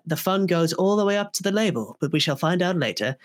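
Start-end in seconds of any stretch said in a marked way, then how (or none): none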